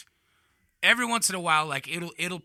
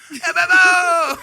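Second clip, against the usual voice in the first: very high, loud, drawn-out holler; syllable-dropping mock yell; 14 semitones higher, 9 dB louder than usual. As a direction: bellow it slowly, swallowing the syllables. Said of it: "you lose syllables as it goes".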